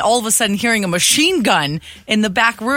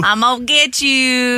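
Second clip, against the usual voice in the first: higher, drawn-out parrot mimicry; imitated parrot; 6 semitones higher than usual. Co-host copying the parrot clip back, drawn-out parrot imitation. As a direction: mimic the talking parrot, stretching the end. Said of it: "The parrot saying".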